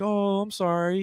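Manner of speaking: polite high sympathy, lightly hammed; mock sympathy. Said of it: "you're like".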